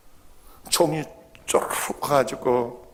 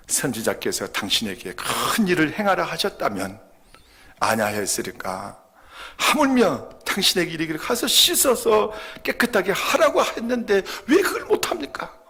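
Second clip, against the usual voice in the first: reproachful servant; 7 semitones higher than usual. Acted. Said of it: "수행했던 한 사람이 이렇게 말합니다".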